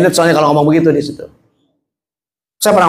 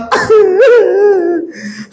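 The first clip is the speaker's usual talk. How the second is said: high, drawn-out acted wail; mock crying. Abu Bakar weeping before the blind man, mock crying. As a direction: wail high, falling away. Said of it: "Nangis sebuah kali".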